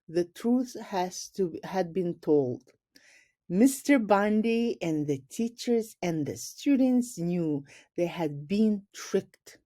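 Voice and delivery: usual speaking voice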